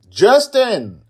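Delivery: loud, high shout of his name. Acted. Sister yelling at brother, loud shout. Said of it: "Angela shouts".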